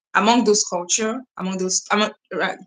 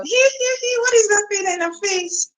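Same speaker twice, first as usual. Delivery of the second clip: much higher, tittering mock voice; mock giggly viewer; 13 semitones higher than usual. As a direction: play the curious viewer with a silly giggle. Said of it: "The question is coming from the point of".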